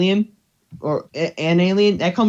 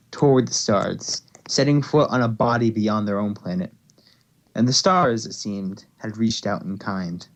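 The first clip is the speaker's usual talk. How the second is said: story narration; 5 semitones lower than usual. Acted. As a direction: read it like the closing of a tale. Reads closing of fic aloud.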